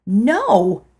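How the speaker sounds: sharp loud refusal; yelled no. A narrator's reading. Narrator yelling a refusal at the dream woman. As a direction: shout it back at the old woman. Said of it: "I yelled".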